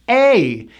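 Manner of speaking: A as a long, gliding AY; A, held vowel; shaped A sound. Shown as a demonstration. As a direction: shape A slowly, falling. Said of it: "It is simply the A sound".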